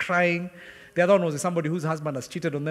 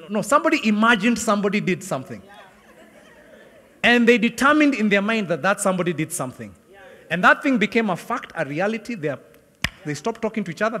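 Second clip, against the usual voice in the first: louder, higher preacher's rising list; preaching build; 5 semitones higher, 6 dB louder than usual. Acted. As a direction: build each line to a peak.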